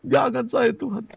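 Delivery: high, loud plea to be spared; hammed-up desperate prayer; retold earnest prayer. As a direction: beg God in mock panic. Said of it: "saya berdoa sungguh-sungguh".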